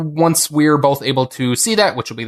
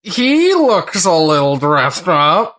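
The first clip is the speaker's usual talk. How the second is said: slow, higher, louder put-on penguin voice; improv penguin; 4 semitones higher, 4 dB louder, much slower than usual. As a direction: drawl it out like a posh dressed-up penguin. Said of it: "sitting in a restaurant and a penguin walks in".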